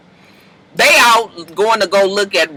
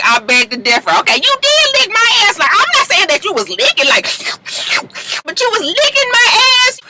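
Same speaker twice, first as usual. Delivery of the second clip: high, sassy Pooh impression; 11 semitones higher than usual. Pooh telling Carly off at breakfast, high sassy voice. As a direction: pitch way up, cheeky. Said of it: "Pooh was just like".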